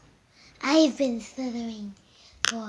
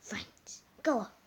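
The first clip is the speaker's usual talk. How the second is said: whispering; 6 dB quieter, slower than usual. Pretend. Whispered command.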